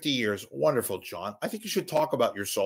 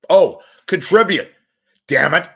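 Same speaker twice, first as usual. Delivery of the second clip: higher, louder demand to contribute; shouting; 7 semitones higher, 11 dB louder than usual. Real, not acted. Begging listeners for money, a loud higher shout. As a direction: bark the plea at the listeners.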